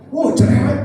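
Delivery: hammed-up snippy devil; annoyed devil. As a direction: toss it off like an irritated villain.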